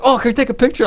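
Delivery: high, loud eager asking; starstruck fans. Retold or imitated. Indonesian fans asking the sniper for a picture, high and excited. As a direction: beg for a photo like a fanboy.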